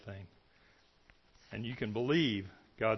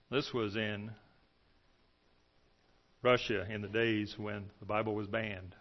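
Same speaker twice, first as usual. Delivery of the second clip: ordinary delivery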